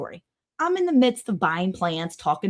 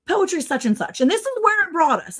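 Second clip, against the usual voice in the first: lightly voiced old man, higher and halting; old man impression; 9 semitones higher than usual. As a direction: imitate an elderly customer searching for the name. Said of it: "he's, like, in his nineties".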